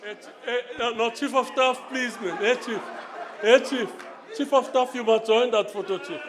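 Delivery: loud call across room. Real, not acted. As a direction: call him over loudly. Calling chief over for photo.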